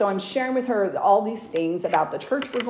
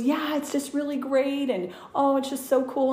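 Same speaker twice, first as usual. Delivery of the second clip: lightly raised gushing voice; imitating the bride; 8 semitones higher than usual. Bride gushing to the hairdresser about her fiance, raised pitch. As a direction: gush a bit higher like the bride.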